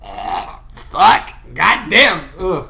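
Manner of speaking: loud, revolted groans and cursing; disgusted outburst. Retold or imitated. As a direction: groan it out, grossed out. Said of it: "I was like".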